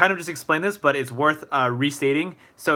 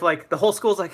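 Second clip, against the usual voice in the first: usual speaking voice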